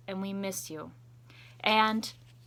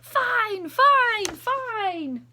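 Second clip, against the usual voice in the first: high, loud crowd cheer; cheering shout; 14 semitones higher, 11 dB louder, much slower than usual. Acted. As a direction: shout it out in joy. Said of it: "teachers shout".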